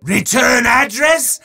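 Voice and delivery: hammy dark-lord decree rising to a yell; evil wizard proclamation. Acted. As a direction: relish every sinister word like a horror host.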